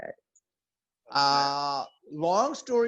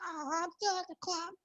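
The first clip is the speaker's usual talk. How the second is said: put-on girlfriend's excuse; 13 semitones higher, 8 dB quieter, faster than usual. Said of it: "She's like".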